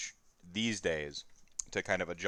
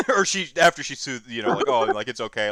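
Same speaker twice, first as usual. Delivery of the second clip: amused, higher and louder through laughter; real laugh breaking into the story; 4 semitones higher, 9 dB louder than usual. Real, not acted. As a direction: tell it while cracking up. Laughing while telling the anecdote.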